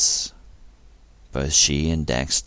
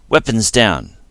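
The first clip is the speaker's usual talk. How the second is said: loud commanding call; a captain's sharp command; firm order; 8 semitones higher, 8 dB louder than usual. A narrator's reading. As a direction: stop the crew with authority. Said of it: "Dax ordered".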